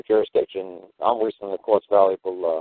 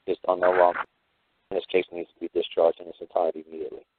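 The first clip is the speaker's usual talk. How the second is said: lower, softer, measured address to the judge; formal court address; 3 semitones lower, 3 dB quieter than usual. Retold or imitated. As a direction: argue it gravely, like counsel.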